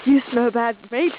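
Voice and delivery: goofy put-on Australian voice; hammed-up Aussie explorer accent; mock Australian.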